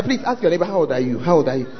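ordinary delivery

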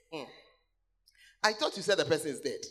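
ordinary talk

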